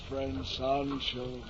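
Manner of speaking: weak words from the ground; slow, faint and spent. Acted. Fallen knight, weak.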